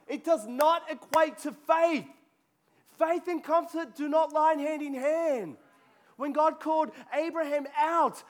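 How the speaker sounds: fiery sermon push; full-force preaching. Acted. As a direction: preach it hard to the room.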